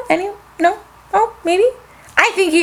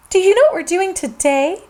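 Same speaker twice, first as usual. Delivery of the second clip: ordinary delivery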